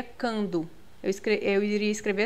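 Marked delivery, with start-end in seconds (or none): 0.0-0.7 cando with plain k, no w glide; U dropped. Shown as a demonstration. Teacher shows the wrong form cando, U dropped. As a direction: say cando as kan-do, dropping the U sound. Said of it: "se fosse dessa forma aqui, seria".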